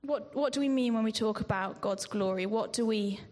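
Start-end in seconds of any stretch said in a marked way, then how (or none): none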